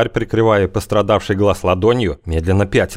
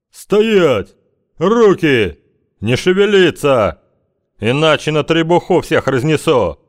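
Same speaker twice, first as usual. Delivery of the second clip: barked command; 8 semitones higher, slower than usual. Acted. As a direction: bellow the orders to freeze. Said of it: "лужёная глотка Смыкова".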